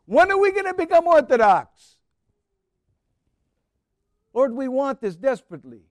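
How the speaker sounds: loud, high desperate cry for mercy. Acted. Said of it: "She cried out".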